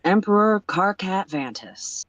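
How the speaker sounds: measured, slow official greeting; slow, formal presidential greeting. Acted. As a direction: address the emperor like a head of state.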